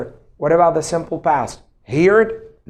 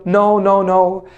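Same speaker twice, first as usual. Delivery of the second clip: usual speaking voice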